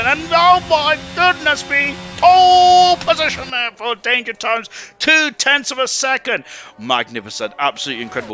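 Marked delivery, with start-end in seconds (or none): 0.0-6.4 soaring, slow, thrilled call; excited commentary. Real, not acted. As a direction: call the pole lap like a big moment. Pole lap celebrated in live commentary.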